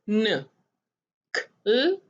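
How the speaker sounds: ordinary talk, nothing marked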